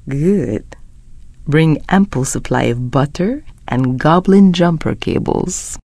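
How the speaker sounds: soft, low, seductive near-whisper; flirtatious murmur. Acted. Character flirting with a darling, soft low seductive voice. Flirting lines, softly spoken.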